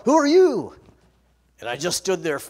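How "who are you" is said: loud high shout; startled yell. Retold or imitated. Rancher shouts at unknown driver, loud and high. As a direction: shout it at a stranger in the dark. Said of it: "And he yelled".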